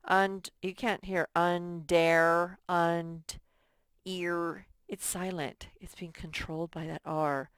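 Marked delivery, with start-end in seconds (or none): 0.1-2.6 slow "Und-" then "under" with the R swallowing the E; sound it out. Shown as a demonstration. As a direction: break "under" apart slowly to show the silent E. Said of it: "R controlled E".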